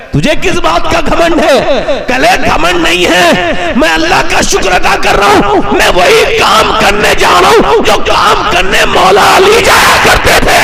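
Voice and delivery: rising fervent sermon voice; preaching at full force. Acted. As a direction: build it up until you cry it out. Preacher building to climax.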